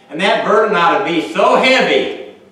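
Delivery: loud, high preacher's cry; fervent preaching. Acted. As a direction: preach it out at full force. Preaching burst.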